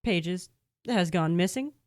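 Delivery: slow, careful confession; slow nervous confession. Acted. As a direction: ease the bad news out.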